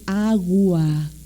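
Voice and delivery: put-on baby-talk parent. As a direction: say "Agua" slowly for a toddler to copy. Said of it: "porque hablamos chistoso con ellos". ("Agua" alargado, como papá enseñando a hablar)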